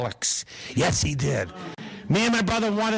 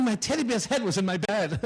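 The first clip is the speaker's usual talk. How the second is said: usual speaking voice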